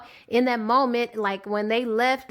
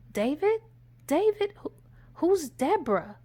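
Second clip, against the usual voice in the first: high, halting retold bewilderment; baffled questioning; 6 semitones higher than usual. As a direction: call his name as a puzzled question. Woman baffled by his sudden switch.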